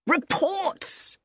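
high-pitched outburst at normal volume; loud exclamation. A narrator's reading. Colonel pacing the office fed up with reports, exclaiming. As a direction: exclaim it sharply, pitched well up. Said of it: "he exclaimed loudly".

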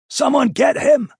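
loud, high commanding call; shouting. A narrator's reading. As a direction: yell the order over the battle. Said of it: "the prince called".

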